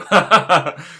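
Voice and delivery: short, voiced chuckle; amused laugh. Real, not acted. Speaker amused by mud from the pigs, chuckling.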